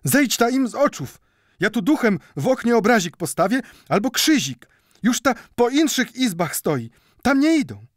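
high, loud, breathless folk voice of a frightened woman; urgent caretaker woman. A narrator's reading. Caretaker woman urging Mendel to hide from the mob, loud and high.

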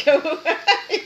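real laugh breaking through the words. Real, not acted. Host joking to viewers about the rushed video, laughing.

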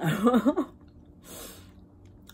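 a high, loud burst of laughter. Real, not acted. Recovering from the wasabi, a loud high laugh. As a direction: laugh it off after the burn.

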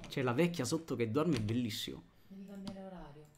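hushed voice. Real, not acted. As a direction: keep it down, she's sleeping below. Old lady sleeps below.

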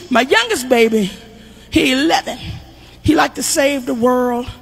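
ordinary talk

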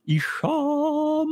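announcer voice. Acted. Host announces next guest.

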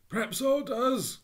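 wary, loud Cockney hedge; a cautious Cockney burglar. Acted. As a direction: answer guardedly like a suspicious thief. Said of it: "cautious rejoinder".

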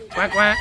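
imitating the ducks for little Sandra, playful quacking. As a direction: quack like a duck for the kid.

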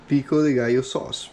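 usual speaking voice

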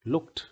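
"लुक्ड" in one syllable, "lukt". Shown as a demonstration. Teacher repeats the corrected word, -ed pronounced "t". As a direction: end "लुक्ड" on a clean t. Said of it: "ट बोला जा रहा है आखिरी".

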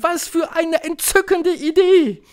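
high, loud delighted cry; high-pitched enthusiastic shriek; screeching witches. A narrator's reading. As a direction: squeal it like a thrilled coven. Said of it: "kreischten die Hexen".

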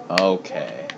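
usual speaking voice

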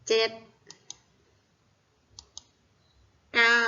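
each digit alone, very long pauses between; widely spaced teacher's dictation. Shown as a demonstration. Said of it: "แล้วก็บอกตามตัวเลขเลย".

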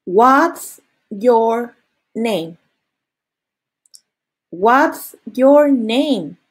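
very slow, word by word; slow model phrase. Shown as a demonstration. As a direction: spell it out slowly for learners. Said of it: "Listen and repeat".

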